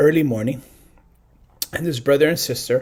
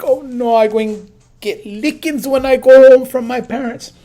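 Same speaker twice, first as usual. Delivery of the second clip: put-on boy's worried cry, higher and louder; a kid fearing a spanking, raised voice; mock panic; 7 semitones higher, 8 dB louder than usual. Panicking boy voiced in story.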